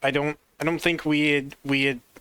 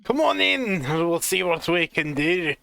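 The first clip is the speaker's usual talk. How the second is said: welcoming Scottish dwarf, higher on the invite; Scottish accent; 3 semitones higher than usual. Acted. Castle dwarf inviting the travelers in, hearty Scottish. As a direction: wave them in, hearty Scots. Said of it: "rough Scottish accent".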